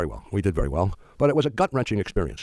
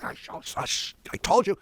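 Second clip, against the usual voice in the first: mad partner; 12 semitones higher, 3 dB quieter than usual. Retold or imitated. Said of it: "Oh, he was mad".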